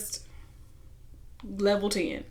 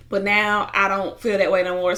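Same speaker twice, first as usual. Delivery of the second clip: ordinary talk, nothing marked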